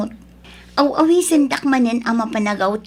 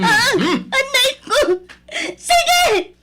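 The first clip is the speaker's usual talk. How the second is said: much higher crying yell; hysterical shouting; 10 semitones higher than usual. Acted. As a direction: scream through tears, out of control. Wife screams at husband in fight.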